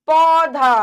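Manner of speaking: पौधा loud with a wide, open au; wide open mouth. Shown as a demonstration. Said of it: "बड़ा मुंह भी खुल जाता है". (पौधा को मुंह खोलकर, ज़ोर से बोलें)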